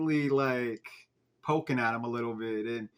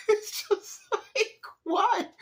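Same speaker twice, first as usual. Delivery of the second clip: very high, squeaky laughing bewilderment; helpless laughter; much slower than usual. Real, not acted. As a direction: barely get it out through laughter. Cracking up at the garbled line.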